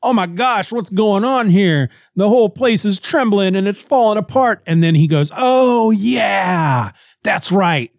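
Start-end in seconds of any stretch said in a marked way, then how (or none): 0.0-4.6 high, clueless mock alarm; hammed-up confused hero; mock bewilderment. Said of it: "he has no idea what's going on suddenly". 5.4-7.9 drawn-out, higher "oh yeah"; comic moment of remembering; mock realization. Acted sudden remembering.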